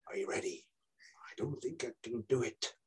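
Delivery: whispery Irish detective, very soft; hushed. Acted. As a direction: drop to a tense hush in an Irish accent. Acting detective demonstrating the Dyson.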